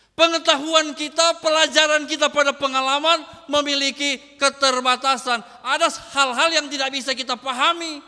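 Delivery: loud, driving sermon delivery with a punch on each kau; pounding out kalau kau like a revival preacher; full-force preaching. Acted. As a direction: preach it hard, building the rhythm.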